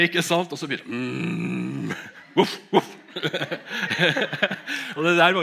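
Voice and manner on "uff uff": a high, loud imitated woof; playful dog bark imitation, telling the story; mock dog bark. Dog starts barking at night.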